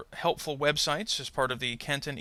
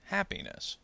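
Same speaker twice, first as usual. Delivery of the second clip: HAPPINESS. with the first syllable stressed and higher; 6 semitones higher, 6 dB quieter than usual. Shown as a demonstration. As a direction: keep the stress on "Hap" in "Happiness.". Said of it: "the stress does not change".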